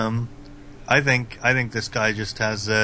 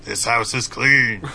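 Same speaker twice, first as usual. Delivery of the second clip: slow, solemn medium declaring; ghost hunter medium voice; slower than usual. Pretend. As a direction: pronounce it like a TV psychic. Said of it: "Most Haunted episode".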